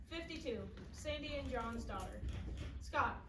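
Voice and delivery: ordinary delivery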